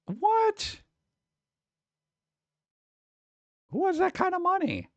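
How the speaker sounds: high, stunned what; squeaky high disbelief; shocked. Real, not acted. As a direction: disbelief at the price. Shocked at gold ornament price.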